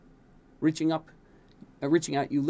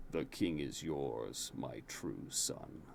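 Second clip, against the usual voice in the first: much lower, slow, softer solemn voice; bow and hand over the crown gravely; low solemn king; 11 semitones lower, 11 dB quieter, much slower than usual. A narrator's reading. King to his new heir, low and solemn.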